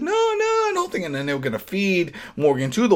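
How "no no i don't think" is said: falsetto squeak of a protest; mock high squeak. Wife pleading to stop them, in a mock high squeak. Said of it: "she's like".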